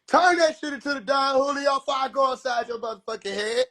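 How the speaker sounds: mama shout. Acted. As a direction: yell it like a mother at the end of her patience. Mama threatening Jesus.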